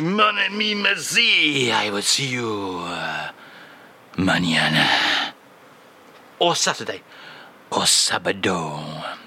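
put-on slow, low French-accented crooner voice; a slow French charmer's voice.

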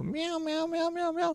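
high, soft, thin kitten meows; cat meow. Pretend. Teased as a cat, host answers with soft high meows. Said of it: "[name] ਬਿੱਲੇ".

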